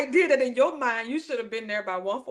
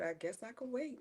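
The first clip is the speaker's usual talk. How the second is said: meek resignation; 5 semitones lower, 17 dB quieter than usual. Retold or imitated. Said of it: "I said".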